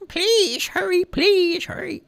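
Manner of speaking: high, slow pleading please hurry; old man begging. A narrator's reading. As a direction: urge him on, frail and desperate. Old man begs the dog.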